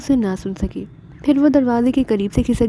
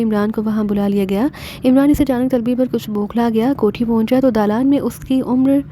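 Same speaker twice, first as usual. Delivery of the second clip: ordinary delivery, nothing marked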